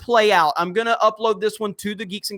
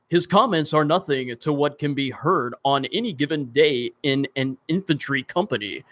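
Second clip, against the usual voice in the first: lower-pitched, properly enunciated broadcast reading; 5 semitones lower than usual. Shown as a demonstration. Streamer mocking viewers' wish to read properly, very proper. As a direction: read it properly in a low, clean voice. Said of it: "be very proper every single live stream and read properly".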